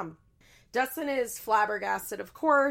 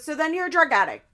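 loud, higher-pitched imitated accusation; mock scolding; 9 semitones higher, 9 dB louder, faster than usual. Mom accusing her daughter, mock scolding. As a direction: raise your voice like an exasperated mom. Retold mother-daughter screaming fight.

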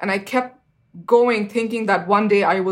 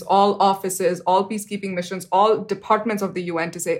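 usual speaking voice

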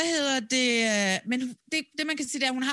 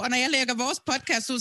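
normal talking voice

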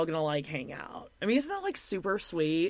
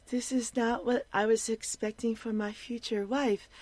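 higher, whiny put-on voice; mocking the husband; 4 semitones higher than usual. Host quoting the husband's complaint: whiny mock voice. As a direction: whine like a mama's boy. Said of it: "Direct quotes".

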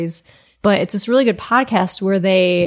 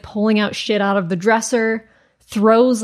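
normal talking voice

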